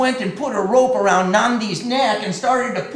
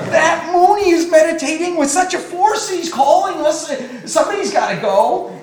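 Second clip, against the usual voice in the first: louder, higher fussing; flustered goddess; 8 semitones higher, 7 dB louder than usual. Acted. Goddesses arguing over who must go, higher and louder. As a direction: pass the job off in a hurry. Goddesses bicker about going.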